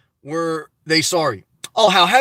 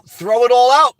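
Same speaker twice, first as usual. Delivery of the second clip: louder and slower, pushing the point home; emphatic urging; 4 semitones higher, 7 dB louder than usual. Real, not acted. To a viewer clearing out her house, emphatic louder urging. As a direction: really drive it: throw it ALL out.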